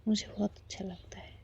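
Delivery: whispered reassurance; a soft whispered declaration to the wife; tender whisper. Acted. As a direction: breathe it lovingly into her ear.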